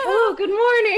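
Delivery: high, loud, overly sweet hello; sweet polite phone greeting. Retold or imitated. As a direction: greet the dad in a sugary voice. Translating her own greeting.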